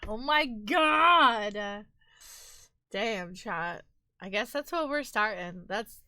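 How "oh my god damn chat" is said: ordinary talk, nothing marked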